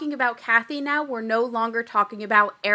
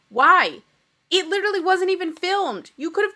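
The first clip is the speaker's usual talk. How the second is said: exasperated outburst; 6 semitones higher, 7 dB louder than usual. Real, not acted. Vlogger exasperated at the cast.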